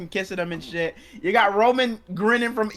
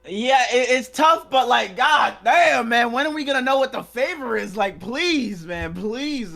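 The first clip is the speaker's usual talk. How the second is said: higher and louder, slowed on god damn; excited outburst; 5 semitones higher, 4 dB louder, slower than usual. Real, not acted. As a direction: burst out, impatient for the answer. Frustrated outburst over the cliffhanger.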